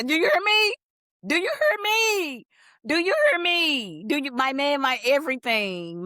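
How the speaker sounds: squealing with love, high and loud; swooning, high and loud. Real, not acted. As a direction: gush it out like a fangirl. Swooning over her book crush.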